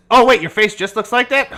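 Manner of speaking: put-on old doctor startled by a face; startled surprise. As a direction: recoil at a strange face. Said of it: "He says".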